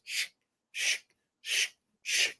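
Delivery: whispered shh shh like a blade on a whetstone; breathy imitated sharpening scrape. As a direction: hiss out the sharpening strokes softly. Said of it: "all you hear is".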